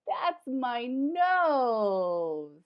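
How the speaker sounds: very slow loud protest; mock indignant. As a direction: drag it out in comic outrage. Character protesting being pressed.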